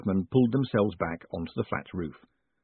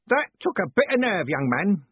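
put-on old Parker roaring; 8 semitones higher than usual. A narrator's reading. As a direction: roar it like a deaf old soldier.

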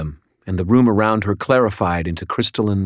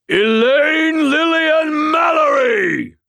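slow booming shout, much higher and louder; rumbling mountain spirit; 5 dB louder, slower than usual. A narrator's reading. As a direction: thunder it out like a god. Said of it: "my voice rumbled like thunder".

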